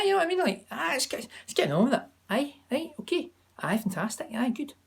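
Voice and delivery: high, run-together Scottish chat; words flowing up and down like blended noise in a Scottish accent. Shown as a demonstration. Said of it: "So I say".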